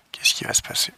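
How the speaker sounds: unvoiced whisper to himself. Real, not acted. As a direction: whisper it under your breath. Quiet aside while installing.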